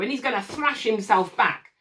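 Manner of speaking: ordinary talk, nothing marked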